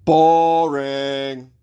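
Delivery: drawn-out, monotone heckle; boring voice. Acted. Newsreader mocking an ikea spokesperson, boring drone. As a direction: stretch it out in a dull drone. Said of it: "Let me do a boring voice".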